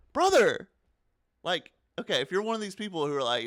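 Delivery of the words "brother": high, loud brother!; exasperated outcry. Real, not acted. Host reacting to fans' replies, loud exasperated outcry. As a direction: blurt it out in disbelief.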